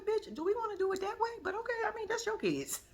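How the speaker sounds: pointed disbelief dressed as politeness; judging a mother's choice; sarcastic side-eye. Retold or imitated. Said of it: "I said".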